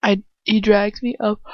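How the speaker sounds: usual speaking voice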